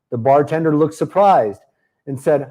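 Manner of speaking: ordinary delivery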